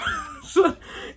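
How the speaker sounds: a very high squeal of laughter. Real, not acted. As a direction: laugh at the absurdity. Laughing at the situation.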